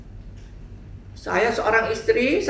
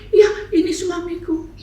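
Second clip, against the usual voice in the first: happy; 9 semitones higher, 5 dB louder than usual. Retold or imitated. Girl introduces husband happily.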